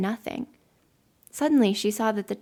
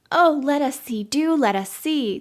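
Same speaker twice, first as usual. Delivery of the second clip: eager cry; 5 semitones higher, 5 dB louder than usual. A narrator's reading. Children begging the village girl to show them, eager cry. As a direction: beg eagerly, stretching the plea. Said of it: "the children cried, both at once".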